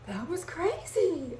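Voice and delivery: high, slow, awestruck "That was crazy"; amazed disciples. Retold or imitated. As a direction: stretch it out in wide-eyed wonder. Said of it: "And the disciples are like".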